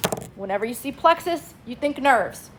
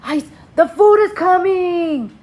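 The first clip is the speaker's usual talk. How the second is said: higher, louder, slow cartoon voice of eager nerves; excited nerve cells; 6 semitones higher, 4 dB louder, much slower than usual. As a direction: play the nerves hyped that food is coming. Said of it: "They're like".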